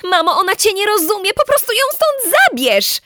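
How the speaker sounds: high, fast, urgent pleading; rising desperate plea. Acted. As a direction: beg mum to take her away. Begging mother to remove baby.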